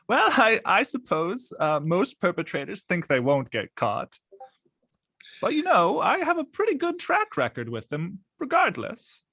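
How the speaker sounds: high-pitched, playful character voice; lightly amused character. Acted. Ida answers after a little chuckle, high playful voice. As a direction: lift the pitch high and swoop through it. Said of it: "she chuckles a bit".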